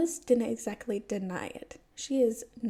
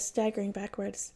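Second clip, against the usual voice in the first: ordinary delivery, nothing marked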